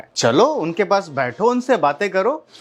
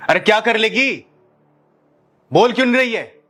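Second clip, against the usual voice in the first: high, taunting demand; angry challenge; 6 semitones higher than usual. Acted. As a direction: goad for an answer with pitch raised. Husband challenges wife to answer.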